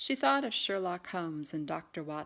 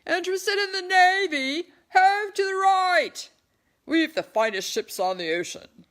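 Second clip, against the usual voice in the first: much higher and louder recruiting call; bellowing; 13 semitones higher, 13 dB louder than usual. A narrator's reading. Sailors recruiting men in the crowd, bellowing.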